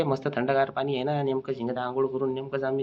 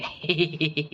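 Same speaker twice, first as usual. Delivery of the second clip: brief giggly chuckle; laugh; 2 semitones higher than usual. Acted. Boys joking while bathing in river.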